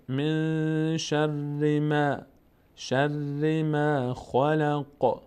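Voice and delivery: من held through the nose before شر; very slow tajweed recitation; nasal ikhfa on من. Shown as a demonstration. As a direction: hum the نون of من into the nose.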